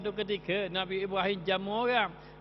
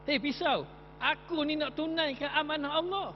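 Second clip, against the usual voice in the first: high, scolding voice; angry at the knife; 8 semitones higher than usual. Acted. Ibrahim scolding the blunt knife in a high indignant voice. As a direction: tell off the knife, pitched up. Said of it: "dia marah ke pisau tu".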